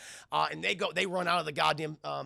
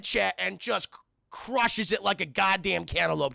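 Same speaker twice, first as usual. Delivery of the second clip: ordinary talk, nothing marked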